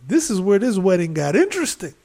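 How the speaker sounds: loud, high, gleeful announcement; excited retelling. Retold or imitated. Guest relishing a wedding fight, excited. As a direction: build up the coming spectacle, pitched high.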